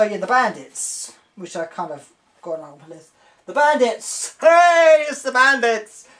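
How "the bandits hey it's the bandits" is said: loud, high cheer; excited cheer. Real, not acted. Excitedly greeting the bandits.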